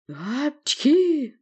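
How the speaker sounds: high, loud acted sneeze; explosive "apchkhi"; blurted sneeze. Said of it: "выпалил я".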